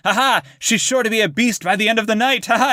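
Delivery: high, put-on jovial Van Helsing; laughing character impression. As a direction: mimic his gleeful laugh mid-line. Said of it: "he's, like, laughing".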